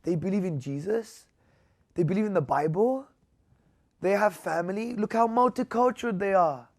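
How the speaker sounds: half-whispered, then rising in amazement; amazed retold quote. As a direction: relay a stunned man's astonishment.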